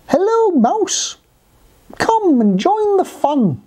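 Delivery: high, slow, sing-out invitation; drawn-out high storybook call. Acted. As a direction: hail a friend from a distance. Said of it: "he called out".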